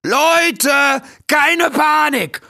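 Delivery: high, loud raccoon shouting to a crowd; rally everyone with drawn-out high calls; calling out. A narrator's reading. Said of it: "schwang er sich auf seine Hinterbeine und rief". (laut und hoch gerufene Durchsage)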